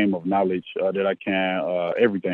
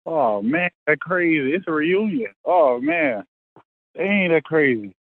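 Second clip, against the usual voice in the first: excited; 8 semitones higher, slower than usual. Real, not acted. Reacts to teammate drafted.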